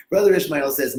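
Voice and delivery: ordinary talk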